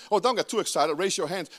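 usual speaking voice

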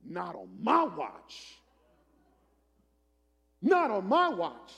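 slow, defiant preacher's declaration; emphatic refusal. Acted. Pastor refusing to yield to gangs, slow and defiant. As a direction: draw it out, punching MY.

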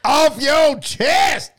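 slow, high, loud show-title shout; announcer shout. Acted. Host shouting the podcast title at the outro, slow and loud.

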